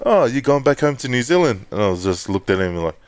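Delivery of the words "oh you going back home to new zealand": higher, mock friendly question; light teasing driver voice; imitating Uber driver. Uber driver asking about going home to New Zealand, lightly mimicked higher. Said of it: "And he goes".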